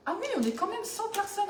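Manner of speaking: high, delighted exclamation; suddenly noticing a big crowd; surprised. Real, not acted. Surprised at viewer count.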